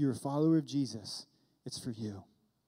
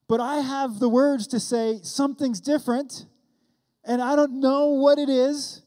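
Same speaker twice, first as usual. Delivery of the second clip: higher, louder passionate sermon voice; fervent preaching; 7 semitones higher, 7 dB louder than usual. Acted. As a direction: preach it with rising fire to the congregation. Preacher builds to full force.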